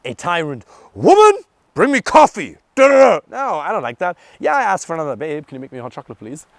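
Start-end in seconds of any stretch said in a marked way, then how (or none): 0.9-3.2 loud, higher barked order; mock caveman demanding coffee; hammed-up domineering husband; bossy tyrant. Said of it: "I'm not interested in being a dictator, a tyrant". 5.2-6.5 sweet ask. Retold or imitated. Polite request to Fernanda, contrasted.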